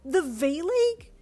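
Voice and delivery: high, slow, loud squeal; excited. A narrator's reading. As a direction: squeal it out in disbelief.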